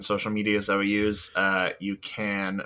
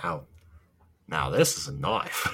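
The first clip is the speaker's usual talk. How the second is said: put-on Australian accent; 4 semitones lower, 4 dB quieter than usual. Australian movie quote follows accent bit.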